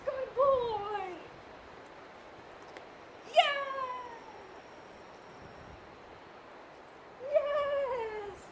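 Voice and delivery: dog trainer cooing praise to her dog while it fetches. Real, not acted. Praising dog during fetch game.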